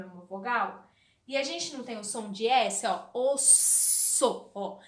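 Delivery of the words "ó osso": "osso" drawn out slowly, the double S held long; slow demo word. Shown as a demonstration. Teacher to kids, slowly drawing out "osso". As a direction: stretch "osso" out, leaning on the S. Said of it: "o som do S é esse".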